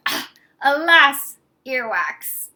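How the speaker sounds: choked, drawn-out groan of disgust; choking. Acted. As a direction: splutter it slowly as if gagging. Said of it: "Then he choked and said".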